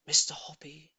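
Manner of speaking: plain reading voice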